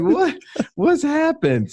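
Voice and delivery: high-pitched, incredulous reaction; retold surprise. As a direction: act out being taken aback, pitch jumping up. Retold reaction to wife's text.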